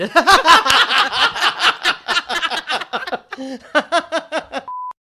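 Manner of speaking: howling high laugh held long; shrieking real laughter; loud laughing fit. Real, not acted. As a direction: burst out cackling at the joke. Host cracks up at own joke.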